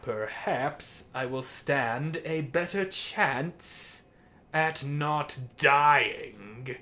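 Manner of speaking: normal talking voice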